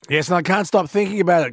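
usual speaking voice

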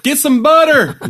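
high, loud mock panic; mock yell. Friend mocking bad burn advice, loud mock yell. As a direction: bark it like a frantic old-timer.

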